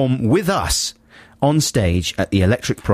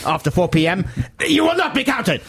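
stern official voice; 9 semitones higher than usual. Acted.

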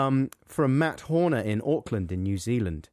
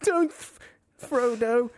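high, broken by laughter; laughing punchline; 9 semitones higher, slower than usual. Real, not acted. Co-host repeating his own pun, giggling. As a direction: squeak it out through giggles.

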